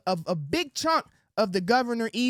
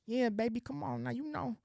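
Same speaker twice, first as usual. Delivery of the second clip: mock sweet-talk, much softer murmur; sweet nothings; 8 dB quieter than usual. Imitating his old self sweet-talking women, soft murmur. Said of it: "Sweet nothings".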